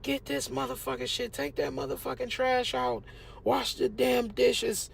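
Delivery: high-pitched mock yell of an angry mom; mom yelling chores. Mom yelling at her son to do chores, high mock yell. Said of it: "you know, yell".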